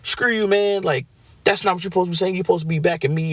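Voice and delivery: imitating an upset friend who feels betrayed; mock pissed-off retort. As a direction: act out a riled-up friend pushing back. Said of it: "if somebody's emotional or pissed off".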